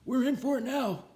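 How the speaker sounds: put-on high, worried old janitor voice. A narrator's reading. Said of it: "said old, old Pop Hansen".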